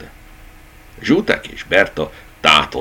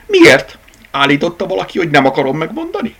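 much higher and louder, then fast; indignant rabbit; 12 semitones higher, 6 dB louder, faster than usual. A narrator's reading. Rabbit retorts to the teddy bear, high and quick. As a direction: fire the questions back fast.

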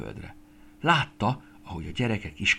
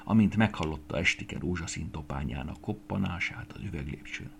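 ordinary delivery, nothing marked

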